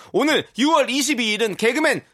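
high loud birthday shout; excited announcement. Real, not acted. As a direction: proclaim it like a big reveal. (신나게 외치는 생일 발표)

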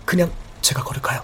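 fast unvoiced whisper. Real, not acted. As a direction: whisper it quickly. Whispers so nobody notices.

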